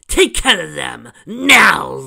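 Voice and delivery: loud order building to a high shout on now; commanding yell. Acted. Chrysalis ordering her changelings to attack, yelling. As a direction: bark the command at her troops.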